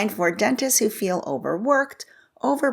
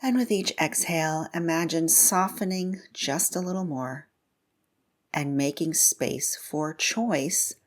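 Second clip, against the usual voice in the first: guided meditation. Real, not acted. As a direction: gently guide them inward, leaving room to breathe. Leads a mindfulness meditation.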